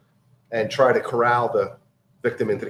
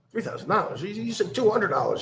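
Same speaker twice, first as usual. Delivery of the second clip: Three thousand dollars pitched much higher in a mock outraged gasp; shocked disbelief; 10 semitones higher, 3 dB quieter than usual. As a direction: echo the price back in stunned disbelief, voice jumping up. Victim shocked by the price.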